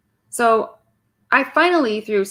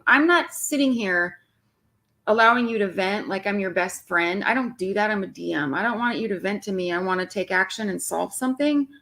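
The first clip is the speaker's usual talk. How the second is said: usual speaking voice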